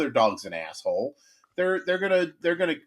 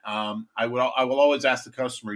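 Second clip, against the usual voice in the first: ordinary talk, nothing marked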